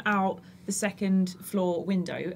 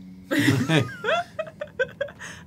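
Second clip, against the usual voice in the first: long laugh. Real, not acted. Laughs at the joke.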